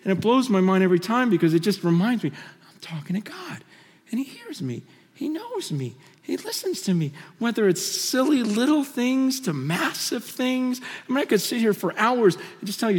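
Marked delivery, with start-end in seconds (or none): 2.3-7.1 hushed, breathless whisper; awed whisper. Real, not acted. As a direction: whisper it in awe. Awed hush about God hearing.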